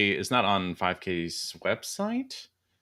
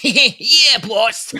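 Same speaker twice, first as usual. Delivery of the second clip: high, loud cackling sidekick voice; giggly henchman; 12 semitones higher, 11 dB louder than usual. Pretend. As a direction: play the laughing lackey. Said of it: "hyena level laughter".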